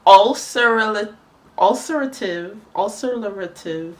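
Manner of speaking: garbled, drawn-out tries at "ulcerative"; struggling to pronounce. Real, not acted. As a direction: sound out "ulcerative" slowly, tripping on extra syllables. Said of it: "I can never say this".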